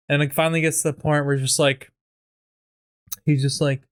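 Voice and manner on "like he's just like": normal talking voice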